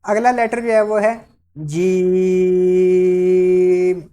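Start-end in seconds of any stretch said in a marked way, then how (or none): 1.6-4.0 جیم held very long and slow; long jeem. Shown as a demonstration. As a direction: stretch جیم out slowly. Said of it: "اس کو تھوڑا سا لمبا کریں گے".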